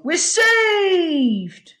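high, drawn-out cheer of relief; jubilant cry. A narrator's reading. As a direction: cry out that rescue has come. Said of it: "cried the Admiral".

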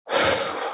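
long theatrical sigh out; exaggerated sigh. Shown as a demonstration. Judging someone with a long disdainful sigh. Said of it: "all five at the same time".

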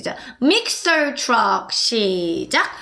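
ordinary talk

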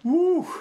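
high, loud cheering whoop. Real, not acted. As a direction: whoop in triumph. Cheering in a fight.

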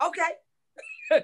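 sudden high yelp, then meek; surprised, sheepish okay; startled okay. Retold or imitated. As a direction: caught off guard by the head coach. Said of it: "And I'm like".

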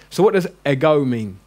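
usual speaking voice

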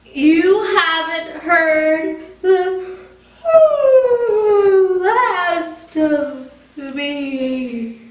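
very slow, drowsy, trailing off into sleep; sleepy fade. Acted. Pigeon drifts off mid-threat, very slow and drowsy. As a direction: let it drag as sleep wins.

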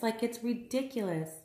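ordinary talk

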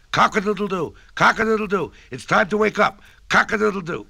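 high, loud rooster call; rooster crowing. A narrator's reading. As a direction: crow it out to wake the farm. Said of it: "a rooster crowed".

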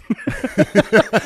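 high, loud burst of laughter; laughing out loud; real laugh. Real, not acted. Laughs at the punchline.

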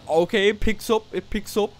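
high and loud, thrilled by the speed; excited shout. Real, not acted. Gamer thrilled by the car's launch, excited shout. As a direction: yell it out as the car launches. Testing hypercar acceleration.

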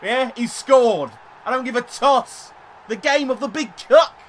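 gloating at the goal; real triumphant shouting; excited celebration. Real, not acted.

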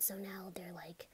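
usual speaking voice

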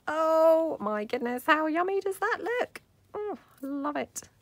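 high, loud, slow gush; gushing delight. Real, not acted. Crafter admiring the finished glossy piece, high excited gush. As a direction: swoon over how it looks.

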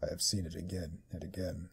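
demonic voice. Acted. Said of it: "I'm giving her a demonic voice".

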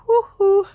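high loud whoo-hoo; crazy whoo-hoo. Retold or imitated. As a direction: whoop like you're a bit crazy. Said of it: "I may be like".

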